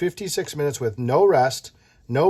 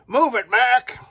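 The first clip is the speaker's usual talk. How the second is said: truck driver holler; 9 semitones higher, 5 dB louder, slower than usual. Acted.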